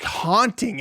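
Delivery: ordinary delivery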